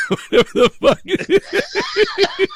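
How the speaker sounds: words squeezed out through high laughter; can barely talk for laughing, slow. Real, not acted. Host cracking up over the caller's line, laughing.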